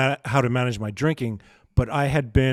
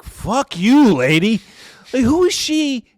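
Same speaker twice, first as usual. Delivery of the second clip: loud, higher mock outburst at the lady; retold angry retort; 10 semitones higher, 8 dB louder, slower than usual. Imitating his own angry reaction to the rude old woman, loud mock outburst. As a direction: snap it back at her in mock fury.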